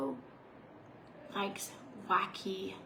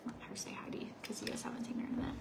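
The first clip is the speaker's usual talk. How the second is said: fast unvoiced whisper; 7 dB quieter, much faster than usual. Real, not acted. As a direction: whisper quickly to the camera. Whispers to viewers.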